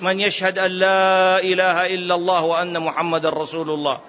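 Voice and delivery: ordinary talk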